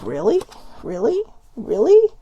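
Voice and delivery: acted disbelief, each one rising further; mock disbelief. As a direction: escalate the incredulous question, ending high.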